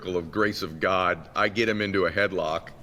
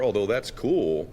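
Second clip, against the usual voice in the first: ordinary talk, nothing marked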